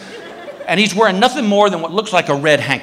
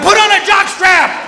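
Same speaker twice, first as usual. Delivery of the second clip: much higher, slow shouted jab; 13 semitones higher, 4 dB louder than usual. Retold or imitated. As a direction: yell it back over your shoulder. Yelling at Paco.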